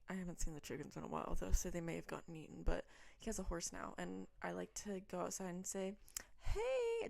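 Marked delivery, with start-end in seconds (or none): 6.4-7.1 high, drawn-out hey; playful high-pitched greeting to an animal; calling hello. Retold or imitated. Greets the neighbour's horse, re-enacted.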